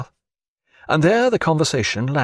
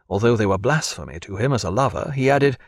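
ordinary delivery

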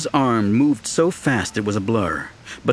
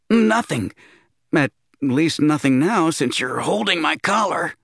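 squeaky nervous voice; 5 semitones higher than usual. Acted. Didi's voice in story.

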